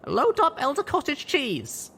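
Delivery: high, loud barked command; barked order. A narrator's reading. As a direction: bark it at him. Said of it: "I barked to Steelhooves".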